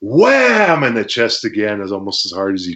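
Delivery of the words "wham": loud high wham; impact sound. Retold or imitated. Wrestler acting out the kick landing, loud wham. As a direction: punch out the hit.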